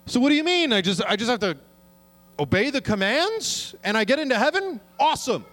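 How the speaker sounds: mock-eager rich man asking Jesus. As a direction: play him smug and chirpy. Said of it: "this person comes up to Jesus".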